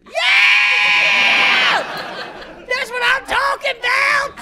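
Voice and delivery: high, loud excited hollering; excited whoop. Pretend. Hard-up guy cheering at hotel movie credits, high excited whoops. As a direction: whoop like the credits are the best part.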